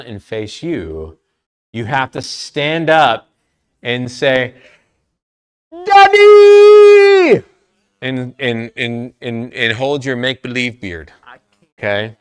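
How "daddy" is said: loud high drawn-out yell; big, high-pitched shout; yelled DADDY. Shown as a demonstration. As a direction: holler it loud and proud, stretching the end. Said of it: "you gotta yell it".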